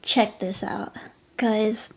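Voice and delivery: ordinary delivery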